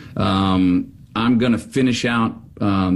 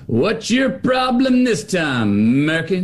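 mock editor; 9 semitones higher, slower than usual. Editor character retorting.

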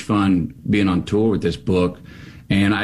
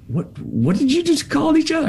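incredulous. Retold or imitated. Makeup man incredulous.

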